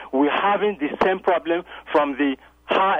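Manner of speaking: ordinary talk, nothing marked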